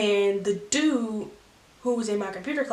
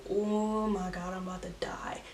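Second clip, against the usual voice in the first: scared whisper; 2 semitones lower, 9 dB quieter than usual. Retold or imitated. Kid in the car whispers in dread while the uncle confronts the boy. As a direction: whisper it like you're doomed.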